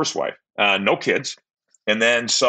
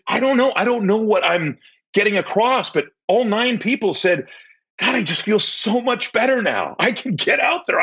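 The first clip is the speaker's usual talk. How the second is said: excited retelling; 13 semitones higher than usual. Retold or imitated. Excited retelling to his aunt.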